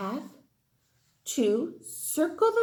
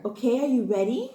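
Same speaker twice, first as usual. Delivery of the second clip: ordinary delivery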